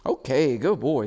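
lilting praise to a dog; upbeat puppy praise. Real, not acted. Praises puppy for ringing bells.

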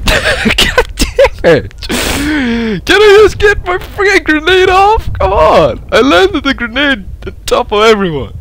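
slow, very high whining protest; frustrated squealing. Real, not acted. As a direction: wail the complaint high and stretched. Grenade throw goes wrong.

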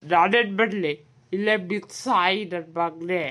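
ordinary talk, nothing marked

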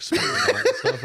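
laughing. Real, not acted. Laughing while telling.

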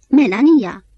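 high, loud cry of the name; exclaiming. A narrator's reading. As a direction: call out to make her stop. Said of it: "exclamă și voi să se oprească".

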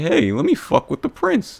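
high-pitched mock of a troublemaker; mocking douchebag. Gamer imitating the douchebag who hit his car, mocking.

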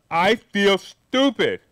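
"I feel stupid" said slowly and evenly; slow plain quote. Retold or imitated. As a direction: lay it out slow and matter-of-fact. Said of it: "she didn't say it like this".

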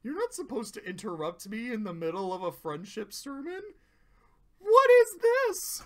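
bewildered fear building to a squeal; terrified confusion. Retold or imitated. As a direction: start hushed and rushed, end in a shriek.

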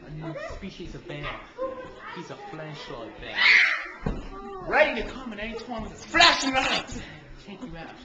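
documentary narrator. Acted. Wildlife narration.

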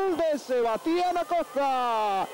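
high, slow drawn-out goal name; stretched goal call. Real, not acted. Goal announcement after call.

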